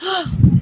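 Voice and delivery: quick high startled gasp; a sudden gasp of surprise; sharp gasp. Real, not acted. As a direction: gasp as the piece gets cut. Sees him about to cut.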